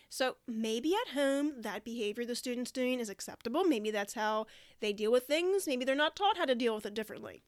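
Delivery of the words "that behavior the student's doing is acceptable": normal talking voice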